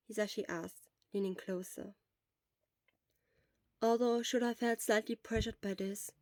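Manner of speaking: ordinary delivery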